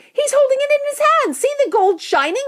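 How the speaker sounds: high-pitched, loud onlooker; excited shouting. A narrator's reading. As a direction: call out in high-voiced wonder. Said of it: "someone else shouted".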